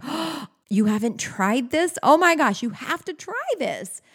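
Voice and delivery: high, gushing disbelief after a gasp; emphatic excitement. Acted. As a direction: urge it on a friend with breathless enthusiasm. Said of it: "so emphatic with their excitement".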